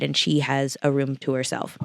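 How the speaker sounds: usual speaking voice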